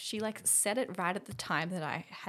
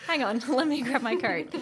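laughing joke; 3 semitones higher, 8 dB louder than usual. Retold or imitated. Joking about fleeing the session.

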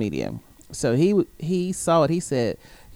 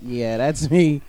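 smug reply; 2 semitones lower, much slower than usual. Retold or imitated. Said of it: "I'm like".

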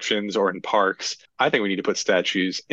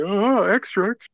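high hammy newsboy shout; mock newsboy cry; 11 semitones higher than usual. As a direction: ham up the paperboy call. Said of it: "I just like".

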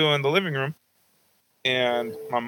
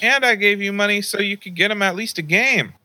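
higher, lightly voiced indignant grandma; grandma scolding; 9 semitones higher than usual. Grandma reminds dad she gave money, higher indignant. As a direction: pitch up, press him like a cross grandmother.

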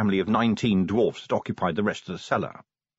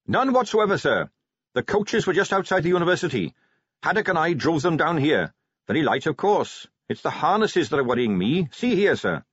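put-on higher eager young Carrot voice; 6 semitones higher than usual.

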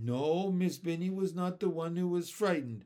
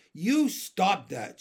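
higher, louder, slow and emphatic; angry; 4 semitones higher, 7 dB louder, slower than usual. A narrator's reading. Old-fashioned girl stamps her foot, angry. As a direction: stretch each word in a cross demand. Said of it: "she said angrily".

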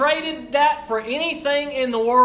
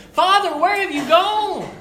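higher, louder anguished plea to the father; anguished cry; 7 semitones higher, 6 dB louder than usual. Retold or imitated. Preacher voicing Jesus calling to his father, anguished. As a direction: cry out to a father who has gone. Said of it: "he wondered".

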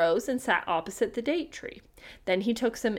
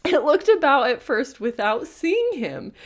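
ordinary talk

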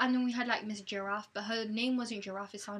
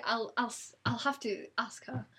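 ordinary talk, nothing marked

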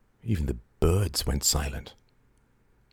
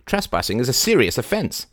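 acid; 7 semitones higher than usual. A narrator's reading. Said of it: "asked Lady Barbara acidly".

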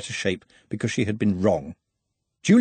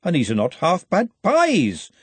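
louder, surprised; 7 semitones higher than usual. A narrator's reading. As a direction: blurt it out in surprise. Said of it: "she said more loudly, in surprise".

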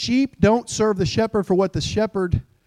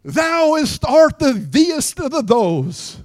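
hammed-up old-English preacher, higher; mock King James; 9 semitones higher, slower than usual. Mocking a believer who prays in King James English, hammed-up and higher. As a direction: ham up a pompous thee-and-thou prophecy. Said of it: "the moment you ask him to pray".

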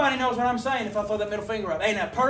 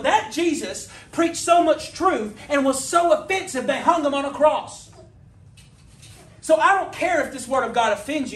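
rising, louder preaching that builds in heat; preaching at full force; 4 semitones higher, 4 dB louder than usual. Acted. Preacher pressing the congregation about offending truth, full force. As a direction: preach it hard and drive the point home.

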